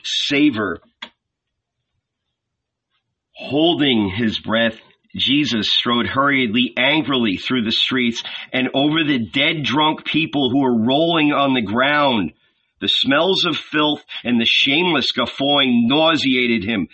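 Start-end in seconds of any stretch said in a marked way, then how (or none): none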